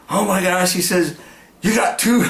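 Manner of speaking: high thrilled wow; retold excitement. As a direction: marvel at the double catch. Buddy seeing two fish hooked.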